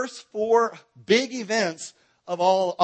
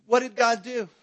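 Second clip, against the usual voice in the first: ordinary talk, nothing marked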